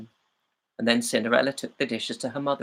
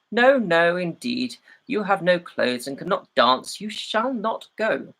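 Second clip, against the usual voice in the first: stepmother's flat refusal, higher and louder on "No, no"; scornful stepmother; 6 semitones higher, 4 dB louder, slower than usual. Acted. Stepmother refusing Cinderella the ball, scornful. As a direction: slam the door on her hopes.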